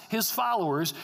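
ordinary delivery

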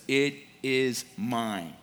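"It is mine" said very slowly, each word weighted; slow, possessive emphasis on every word of "It is mine"; emphatic. Acted. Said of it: "The Hebrew is emphatic here".

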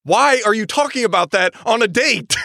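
high, exasperated questioning; incredulous objection. Real, not acted. Reader objecting to talk on a date, incredulous. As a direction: question it in sheer disbelief. Reacting to date's husband question.

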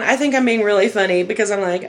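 ordinary delivery

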